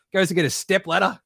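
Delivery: ordinary talk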